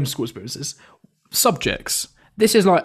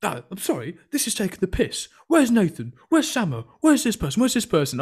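high, exasperated telling-off from the head of year; angry teacher; 7 semitones higher than usual. Retold or imitated. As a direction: scold the missing students like a fed-up teacher. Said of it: "I remember her turning round and going".

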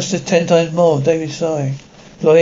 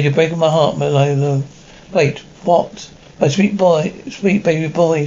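ordinary delivery, nothing marked

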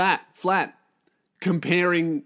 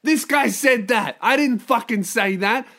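high, loud mock outrage; indignant protest; 7 semitones higher, 4 dB louder than usual. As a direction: deny it in panicked indignation. Boyfriend protests the host's claim.

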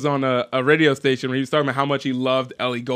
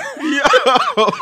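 higher laughing giggle; 9 semitones higher than usual. Real, not acted. Laughs at own joke.

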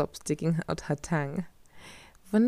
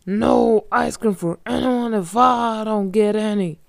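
loud, slow monster roar; ice monster; 6 semitones higher, 9 dB louder, much slower than usual. A narrator's reading. The ice monster guarding the milk dam, slow loud roar. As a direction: bellow it out as a huge monster. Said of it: "he said".

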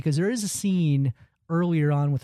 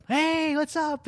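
bright, much higher chirpy hello; high cheery greeting; 13 semitones higher, much slower than usual. Retold or imitated. Popping out of the tarp, chirpy high greeting. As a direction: pop out with a cheerful hi. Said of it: "He jumps out of the tarp".

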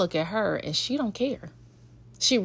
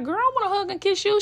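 high playful plea; 12 semitones higher, faster than usual. Retold or imitated. Mom pleading for affection.

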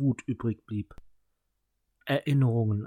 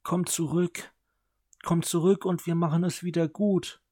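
higher, begging sister; pleading; 6 semitones higher than usual. A narrator's reading. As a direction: plead with him to come back. Sister begs brother.

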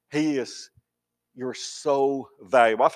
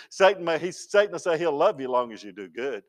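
ordinary talk

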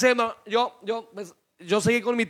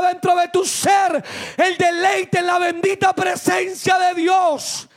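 raised, urgent preacher's voice; preaching; 5 semitones higher than usual. Real, not acted. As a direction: press the congregation hard, line by line. Preaches at full force. (prédica vehemente)

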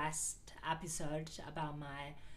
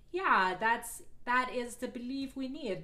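mock zealous creed; 6 semitones higher, 6 dB louder than usual.

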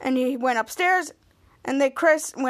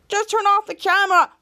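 higher, louder acted exasperation; exasperated outburst; 7 semitones higher, 4 dB louder, slower than usual. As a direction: act out a fed-up complaint. Acted exasperated outburst from the retold show.

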